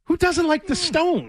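high, slow disbelieving question; incredulous. Real, not acted. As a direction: ask as if it's obvious. Mock incredulous defending music.

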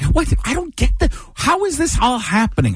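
high-pitched observational-comic incredulity; mock bafflement. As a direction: question it all, voice shooting up. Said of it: "whenever I talk in that manner".

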